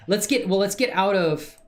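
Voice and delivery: normal talking voice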